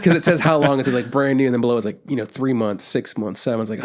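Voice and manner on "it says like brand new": ordinary talk, nothing marked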